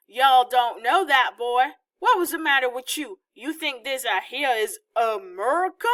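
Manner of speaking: loud Southern hillbilly accent, hammed up. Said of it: "say more Southern style stuff".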